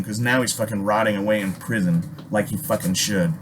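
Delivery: usual speaking voice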